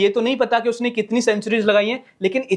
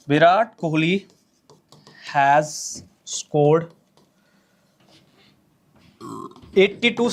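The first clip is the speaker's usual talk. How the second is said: words drawn out one at a time while writing; slow dictation; 3 semitones lower, much slower than usual. Shown as a demonstration. Teacher dictating to students, spelling it out slowly. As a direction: read it slowly so the class can copy.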